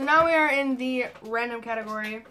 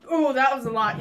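surprised gasp; 3 semitones higher, 9 dB louder than usual. Real, not acted. Shocked at big spoonful.

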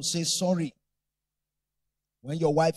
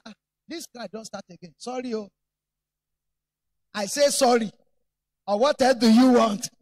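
grudging sorry; 3 semitones higher, 8 dB louder than usual. Retold or imitated. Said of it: "That's not how to say sorry".

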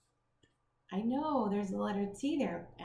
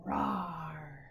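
dinosaur roar; 5 semitones lower than usual. Shown as a demonstration. Reader roars for children, low playful dinosaur roar. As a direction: growl like a friendly dinosaur. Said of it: "do your best dinosaur roar with me".

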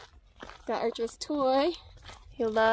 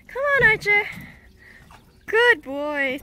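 high, bright encouragement to a nervous dog; high coaxing call to the dog; 8 semitones higher, 4 dB louder than usual. Real, not acted. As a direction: lift the voice to coax him. Coaxing scared dog onto dock.

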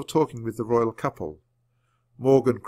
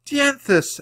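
high, loud cry of the name; excited cry; 5 dB louder than usual. A narrator's reading. Annie spots the unicorn, excited cry. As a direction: cry out in joyful recognition. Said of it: "Annie cried".

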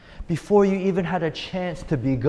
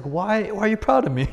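put-on higher, laughing small boy asking why; puzzled little kid; 7 semitones higher, 3 dB louder than usual. Pastor imitates his childhood self asking dad, puzzled child voice. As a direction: play a confused little kid, giggling. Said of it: "I was like".

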